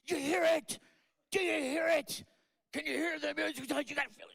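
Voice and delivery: imitating a friend going wild over the music, high and loud; loud, high, ecstatic mock rant. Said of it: "he just was going off".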